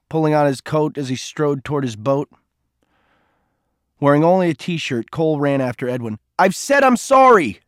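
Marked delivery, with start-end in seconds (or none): 6.4-7.6 much higher, louder and slow, desperate; shouted. A narrator's reading. Cole running after Edwin, desperate slow shout. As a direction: shout it after him, pleading. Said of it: "he shouted".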